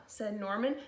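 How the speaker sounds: put-on lively read-aloud to kids; storybook reading. A narrator's reading. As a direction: read it like a picture book to the class. Held story narration for children.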